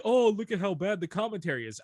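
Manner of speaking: high, loud imitation of critics; mocking complaint. Imitating clip makers who made fun of commentary, mocking.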